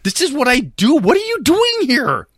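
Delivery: very high, indignant protest; exasperated son. Acted. Cutter defending himself to his mother, high and exasperated. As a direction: pitch climbing in disbelief.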